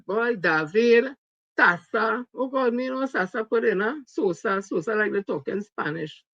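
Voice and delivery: ordinary talk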